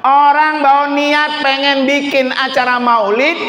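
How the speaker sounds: high, stretched-out pulpit proclamation; preaching. Real, not acted. Preacher gives mothers the story's lesson, full-force preaching.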